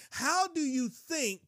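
high, drawn-out disbelief; slow, high, marvelling question; incredulous preaching. Real, not acted. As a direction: stretch the question slowly in amazement. Preacher marvels at Abraham's faith.

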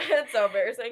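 laughing, loud and embarrassed; laughing through embarrassment. Real, not acted. Embarrassed laughter after voice crack.

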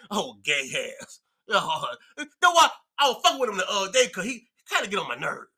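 ordinary talk, nothing marked

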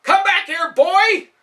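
very high, very loud chasing yell; furious shout. Acted. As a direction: bellow after the fleeing boy. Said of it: "said with a raised fist".

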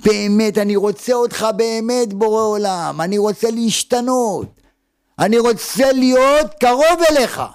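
high, sustained plea to God, swelling at the end; heartfelt crying out. Acted. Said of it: "מי שצעק באמת". (זעקה מעומק הלב, הולכת ומתגברת)

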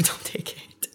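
unvoiced, slow laughing whisper. Real, not acted. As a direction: whisper it as a secret aside. Secret aside to sisters.

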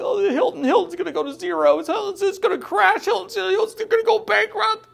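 high, whiny fake panic; mock panicking investor. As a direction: ham up a scared TV pundit. Mocks Ackman panicking on TV.

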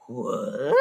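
low, soft questioning grunt; imitated confused grunt, low in the throat; Tim Allen impression. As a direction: do a baffled sitcom-dad grunt. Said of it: "Like Tim Allen".

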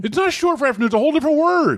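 high, insistent objection; exasperated protest. Real, not acted. Contradicting her claim about Arvo, exasperated protest. Heated playful argument over Arvo.

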